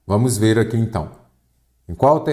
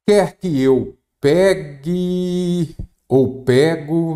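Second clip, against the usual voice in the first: "pegue" hugely stretched and higher, then "pego" set against it; drawn-out contrast; 7 semitones higher, much slower than usual. Shown as a demonstration. Teacher quizzing students on the verb form, drawn-out contrast on "pegue". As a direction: linger long on the ending of "pegue".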